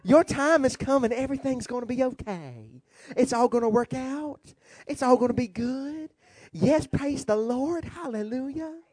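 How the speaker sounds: smooth, soothing preacher impression; Joel Osteen. Reassuring the flock everything's okay, mock smiling preacher. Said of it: "That's how he talks".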